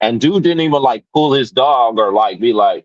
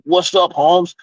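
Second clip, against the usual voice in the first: imitating the customer's street greeting, higher; 9 semitones higher, slower than usual. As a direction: toss it off like a wannabe homeboy. Mocking customer's slang.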